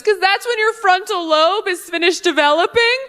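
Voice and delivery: lightly mocked audience know-it-all; know-it-all heckler. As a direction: explain it like it's obvious. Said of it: "She goes".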